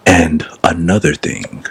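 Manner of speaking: slow, deep pundit impression; Stephen A. Smith. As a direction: drop low and draw it out like a TV debater. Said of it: "listening to Stephen A talk, and he was like".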